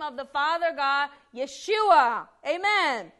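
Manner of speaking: loud, high, emphatic preaching; preaching at full force. Real, not acted. Preacher proclaims Jesus's authority over spirits, fervent and loud. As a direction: drive it home to the congregation, building to the amen.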